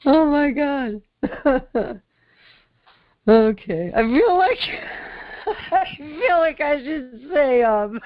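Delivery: helpless laughter breaking up the line; laughing hard. Real, not acted. Co-host cracking up before a disclaimer, laughing hard. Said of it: "I'm laughing so hard".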